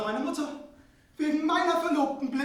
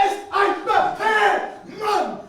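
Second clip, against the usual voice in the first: higher and louder, barking commands; shouted orders; 7 semitones higher, 11 dB louder, much slower than usual. Acted. Prince chases the servants out, shouting orders. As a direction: throw them out of the room.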